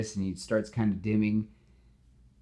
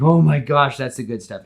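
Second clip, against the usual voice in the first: much louder, higher burst of delight; power high; 5 semitones higher, 11 dB louder than usual. Retold or imitated. Electro savoring the surge, loud delight. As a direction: gush over the rush of power. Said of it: "It's like".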